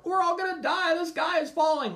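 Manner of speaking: high, loud mock panic. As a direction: squeal like a doomsayer.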